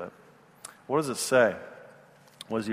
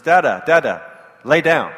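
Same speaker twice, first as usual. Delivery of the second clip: put-on louder toddler command; forceful toddler; 2 semitones higher, 7 dB louder than usual. Toddler orders dad to lie down, loud and bossy. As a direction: boss dad around like a little girl. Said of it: "gets a little more forceful".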